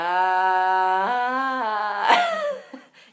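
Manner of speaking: loud cracking squeak forced up high; a strained, cracking demo of a changing voice. Shown as a demonstration. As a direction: push the voice till it breaks into a loud squeak. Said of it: "it just sort of does the thing".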